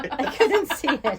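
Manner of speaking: laughing through the words. Real, not acted. Guest laughs at misreading her own shirt, laughing.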